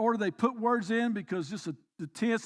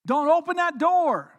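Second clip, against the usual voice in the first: louder, slow and pressing; urgent plea; 5 semitones higher, 7 dB louder than usual. Real, not acted. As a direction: plead with them not to open it. Preacher urging the congregation.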